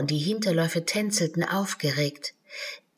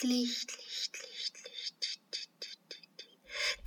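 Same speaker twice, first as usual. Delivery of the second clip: fading echo; 6 semitones higher, 14 dB quieter, slower than usual. Acted. The cave echoes Alice's call back, fading to a whisper. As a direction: echo dying away into a whisper. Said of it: "kam als hundertfaches Echo zurück".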